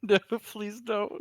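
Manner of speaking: high, slow plea through laughter; giggly, high-pitched protest; laughing plea. Real, not acted. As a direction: beg while still laughing. Laughing plea at bad news.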